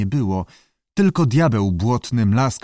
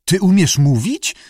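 higher, louder astonished stutter; stammering disbelief; 7 semitones higher, 5 dB louder than usual. A narrator's reading. Keeper stammers at a talking lizard, astonished. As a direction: stammer it out, unable to believe it. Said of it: "wyjąkał pan Greggs".

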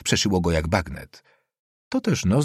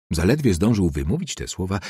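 ordinary delivery, nothing marked

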